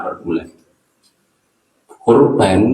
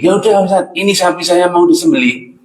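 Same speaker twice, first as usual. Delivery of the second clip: much higher, eager woman's voice; brisk, much higher mother's voice; congregant woman; 8 semitones higher, faster than usual. Retold or imitated. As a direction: play the eager lady offering her cow.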